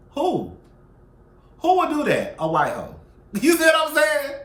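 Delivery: incredulous outburst. Real, not acted.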